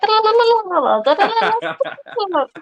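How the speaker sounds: high sped-up chipmunk chatter. Retold or imitated. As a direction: chatter like a fast-forwarded video. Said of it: "listen to them on chipmunk voices".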